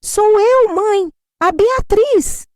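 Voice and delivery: high, slow, shouted over the phone, annoyed; irritated yelling. Retold or imitated. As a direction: yell each word to someone who won't hear. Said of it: "Ela gritava do outro lado, irritada". (filha gritando irritada ao telefone, bem alto e devagar)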